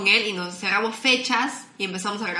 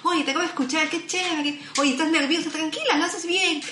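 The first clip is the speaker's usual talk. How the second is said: higher, bright and encouraging; cheerful fan voice; 8 semitones higher than usual. Retold or imitated. A listener messaging the nervous host, cheering her on. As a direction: sweet-talk the nervous host, lightly voiced.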